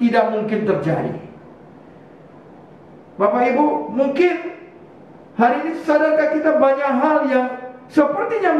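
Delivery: high, lively sermon voice; preaching. Acted. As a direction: preach it to the church.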